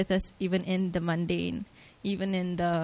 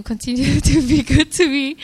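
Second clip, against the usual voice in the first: louder, higher, breaking into a laugh mid-sentence; laughing through it; 7 semitones higher, 8 dB louder than usual. Real, not acted. Thanking God before friends, laughing while she speaks. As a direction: let a happy laugh spill into the words.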